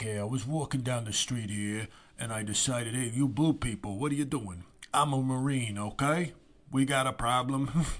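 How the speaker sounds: hammed-up old-timey Brooklyn tough guy; mock 1950s Brooklyn accent. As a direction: play a generic 1950s Brooklyn guy, half-joking. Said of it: "an American from nineteen fifties generic Brooklyn".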